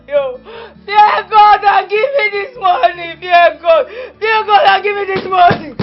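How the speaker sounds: loud, crying defiance; tearful shouting. Acted. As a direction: scream through tears that you won't go. Defiant niece refusing to leave.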